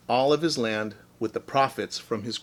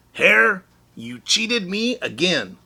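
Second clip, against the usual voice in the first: louder accusing complaint; a cross Bear blaming Hare; scowling Bear; 7 semitones higher, 4 dB louder, slower than usual. Acted. Bear scolding Hare, mild scowl. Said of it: "Bear looked at his pile and scowled".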